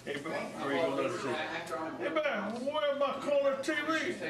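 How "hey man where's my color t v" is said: higher, calling out the demand; demanding husband. Acted.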